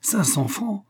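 high-pitched indignant question; incredulous. A narrator's reading. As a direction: echo it in outraged disbelief. Chevalier outraged by low offer.

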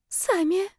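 high, small rising answer; exhaled shyly. A narrator's reading. Girl gives roommate her name, shy high sigh. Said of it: "выдохнула она".

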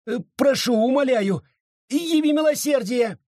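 high, begging, voice climbing in fear; desperate pleading. Acted. Judge begging Abita to spare him, high frantic plea. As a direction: plead for mercy as if the knife is already at you. Judge begs for his life.

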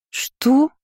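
high-pitched astonished query; drawn-out question. A narrator's reading. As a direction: voice rising high like startled twins. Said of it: "дружно протянули близняшки". (удивлённо, высоко)

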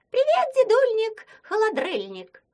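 rubbery high squeaky toy. Acted. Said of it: "сказал писклявым голосом".

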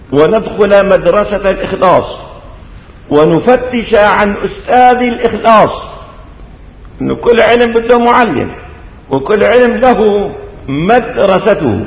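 normal talking voice

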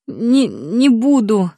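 slow moaning plea. A narrator's reading. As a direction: groan it out in pain. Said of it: "простонала я".

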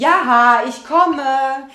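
calling back loudly. Acted. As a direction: call back to the ladies through the door. Ruft ihren Bridgedamen zurück. (laut und höher ins Nebenzimmer gerufen)

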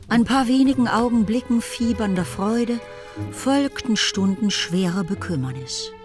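ordinary delivery

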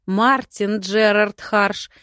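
loud, stern mother's command; mother scolding her son in public; barked order. A narrator's reading. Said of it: "гаркнула она".

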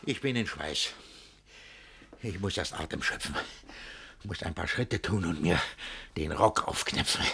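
soft, low panting, fading to breath; breathless recovery. Acted. Master exhausted from raging, soft and breathless. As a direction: catch your breath after shouting.

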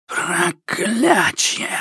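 slow, whispered wheezing curse; hoarse rasping curse. Acted. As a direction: rasp it out like a furious shadow. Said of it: "прохрипела тень".

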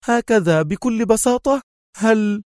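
very high, incredulous question; exclaiming. A narrator's reading. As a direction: cry out in high-pitched astonishment. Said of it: "هتف خالد".

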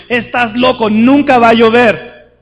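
loud, slow scoffing yell; mocking shout. Crowd mocking Noah, loud slow scoff. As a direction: jeer loudly as if he's crazy. Mocking people of Noah's time.

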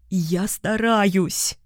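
slow trembling voice; shaky voice. Acted. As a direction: speak with a shaking voice. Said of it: "дрожащим голосом ответила Тиона". (медленно, дрожащим голосом)

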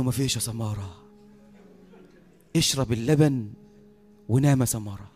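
low, softly lulling voice; soothing sheikh. Retold or imitated. Sheikh coaxing drowsy Samara to drink milk and sleep, soothing. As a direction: gently settle him down to sleep, voice low.

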